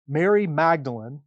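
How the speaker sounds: usual speaking voice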